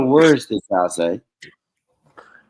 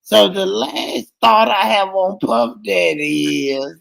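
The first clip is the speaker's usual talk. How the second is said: high, slow, hammed-up failing voice; Sugar's voice giving out; 7 semitones higher, slower than usual. Mocking a streamer's long talk, voice giving all the way out. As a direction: drag it out as if the voice is dying. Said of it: "and then her, her voice just gives all the way out".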